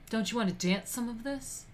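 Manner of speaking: put-on light Italian mobster accent, rising end; a wiseguy's Italian-accented come-on; hint of an Italian gangster accent; Italian mafioso accent. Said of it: "with an Italian accent included".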